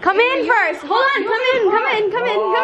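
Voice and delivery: urgent calling. Real, not acted. Host hurrying guests in to see the cake, urgent calling. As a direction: usher them in eagerly, pitch up.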